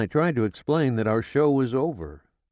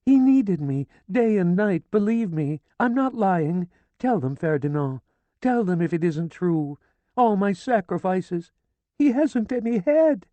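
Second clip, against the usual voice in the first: high, loud desperate protest of innocence; frantic pleading woman; 13 semitones higher, 5 dB louder than usual. A narrator's reading. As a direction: beg the police to believe you. Widow frantically pleading with police.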